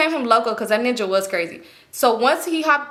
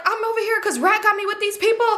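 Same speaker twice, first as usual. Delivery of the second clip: high, gushing chatter through a big smile; beaming happiness; 9 semitones higher, faster than usual. Retold or imitated. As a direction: gush excitedly, relieved to see him. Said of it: "I got the biggest smile on my face".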